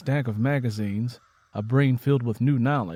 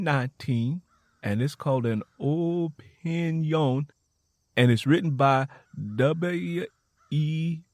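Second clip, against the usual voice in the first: reading slowly; 3 semitones higher, slower than usual. Acted. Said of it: "I'm going to read it nice and slow".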